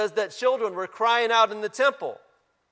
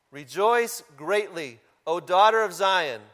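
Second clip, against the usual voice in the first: scripture reading; 5 dB louder than usual. Acted. Pastor reading Zechariah to the congregation, proclaiming.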